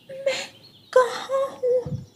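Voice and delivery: put-on high, slow tearful child asking where he is; a small frightened child, slowly crying it out. Lost baby bird asks his mother where he is, slow crying child voice.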